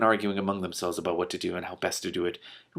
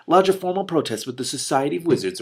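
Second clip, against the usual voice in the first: higher, louder, stuffy dragon voice; a huffy old dragon answering at once, raised and loud; prompt pompous dragon; 6 semitones higher, 6 dB louder than usual. Acted. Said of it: "Roxim answered promptly".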